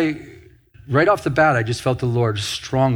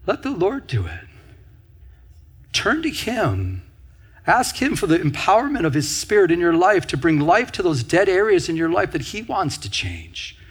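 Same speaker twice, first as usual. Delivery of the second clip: higher-pitched exhortation with weighty pauses; preaching; 4 semitones higher than usual. Acted. Preacher urging listeners to let the Lord do it, preaching. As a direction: urge them earnestly, letting short phrases land.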